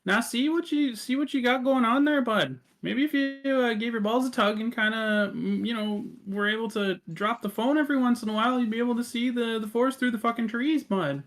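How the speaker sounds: high, chummy scolding voice from above; Death. Acted. Death lecturing the players over top, high scolding character voice. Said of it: "you hear kinda Death's voice over top".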